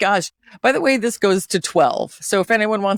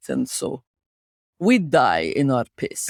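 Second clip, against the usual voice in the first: mock-mournful Russian accent; very sad Russian. Host showing co-host the heartbreak, sad Russian accent. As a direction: sink into a gloomy, resigned Russian accent. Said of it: "I must become very sad".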